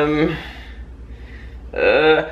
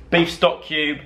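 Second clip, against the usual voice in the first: ordinary talk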